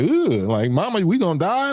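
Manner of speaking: put-on high, whiny kid voice; scared child voice. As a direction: voice the frightened children. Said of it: "they go like".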